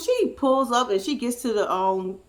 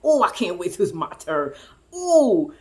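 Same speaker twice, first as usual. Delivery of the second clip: hammed-up anticipation; imagined eager sperm; 4 semitones lower than usual. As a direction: play the impatient swimmer, pitched down. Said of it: "swimming around talking about".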